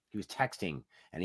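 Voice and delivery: quiet disgusted repeat; hushed repeat. Retold or imitated. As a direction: say it again, hushed.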